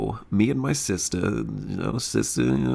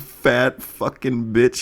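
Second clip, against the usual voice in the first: ordinary talk, nothing marked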